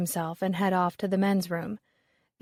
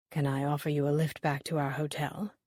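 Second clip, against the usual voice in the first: lower, softer charming offer; flirty invitation; 5 semitones lower, 4 dB quieter than usual. A narrator's reading. Garrett quietly offering Molly a lift, flirty and soft. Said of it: "his eyes twinkling".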